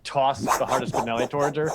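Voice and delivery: normal talking voice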